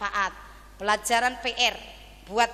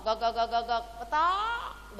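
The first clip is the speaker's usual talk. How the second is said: clucking hen. Retold or imitated. Preacher showing how hens call when laying, clucking. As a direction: cackle like a laying hen, stretch the last cluck. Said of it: "kalau ngendok bengok-bengok".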